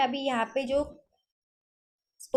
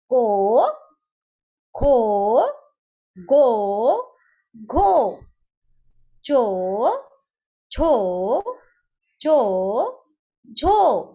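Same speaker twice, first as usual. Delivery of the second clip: each syllable called out loud with a pause for the class to echo; loud repeat-after-me drill; 7 dB louder, much slower than usual. Shown as a demonstration. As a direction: lead the class, one loud sound at a time. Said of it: "आप सभी को मेरे पीछे बोलना है".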